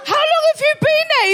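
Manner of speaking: very high, fast startled yelp of a question; shocked demand. Retold or imitated. Woman discovering a man on the sofa, shocked demand. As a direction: fire it out in high-pitched alarm.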